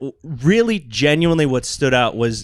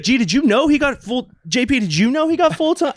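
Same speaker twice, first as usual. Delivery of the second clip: much higher, eager telling everyone; excited announcing; 12 semitones higher than usual. Retold or imitated. Jack telling the others the news, excited and high.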